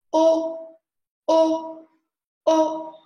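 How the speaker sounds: lips in a circle, loud O three times; rounded O demo. Shown as a demonstration. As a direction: show the round mouth on each O. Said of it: "Regardez ma bouche. Je vais faire un cercle".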